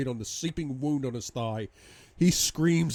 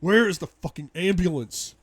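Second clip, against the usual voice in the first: loud, high, exasperated cry; angry demand; 7 semitones higher, 7 dB louder than usual. Acted. Husband beside unconscious wife, angry desperate demand. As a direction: demand help, at your wits' end.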